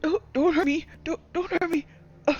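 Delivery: high, fast, panicky pleading halfling; scared halfling. Acted. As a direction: beg for your life in a squeaky cowering voice. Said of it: "and he says".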